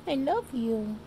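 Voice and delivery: high cooing voice for the cat; doting owner baby-talking her old cat; pet baby talk. Acted.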